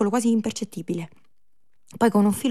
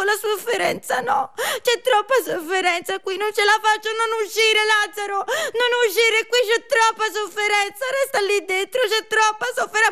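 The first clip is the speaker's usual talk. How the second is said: high, loud anguished wail; overwhelmed by suffering, high and loud; shrieking in melodramatic despair; hysterical wailing figure; 13 semitones higher, 5 dB louder than usual. Acted.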